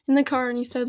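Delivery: normal talking voice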